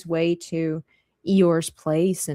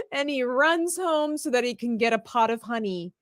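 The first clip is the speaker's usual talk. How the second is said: ordinary talk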